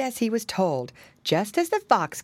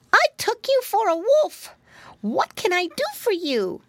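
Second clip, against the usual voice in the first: very high little piglet voice; high, childlike storybook pig; Flop Ear; 13 semitones higher, 3 dB louder than usual. A narrator's reading. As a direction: pipe up like a polite little pig. Piglet talks to muskrat.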